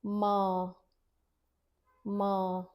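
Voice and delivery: "ma" with nasal vowel, maa(n); "माँ" nasal demo; Hindi ma demo. Shown as a demonstration. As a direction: say "ma" slowly with the vowel through the nose. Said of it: "So it sounds like this".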